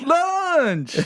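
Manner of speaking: high, stretched Southern LUNCH; Southern accent. Pretend. Co-host trading the word back, in a Southern accent. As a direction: draw "Lunch" out with a twang. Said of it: "with, with this accent".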